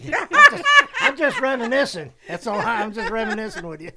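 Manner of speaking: giggling through the words, voice jumping high and loud; laughing. Real, not acted. Friend laughing while teasing the caller about the luncheon. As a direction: laugh it off while insisting you're only reminiscing.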